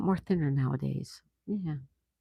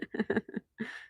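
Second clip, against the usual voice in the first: breathy laugh; 7 semitones higher, 7 dB quieter than usual. Real, not acted. Laughing at chat joke.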